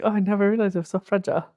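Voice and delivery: high, quick imitation of a character; mock anime quote. Quoting a favorite line, mock dramatic. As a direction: rattle it off high, hammed-up.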